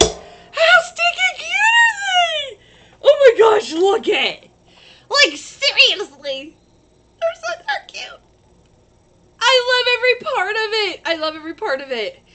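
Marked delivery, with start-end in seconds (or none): 0.0-8.2 very high, loud, slow squealing shouts; excited squeal. Real, not acted. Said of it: "sorry, I get excited about things". 9.4-11.0 "I love every part of it" said high and loud, squealing with delight; excited. Real, not acted. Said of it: "I get excited about things".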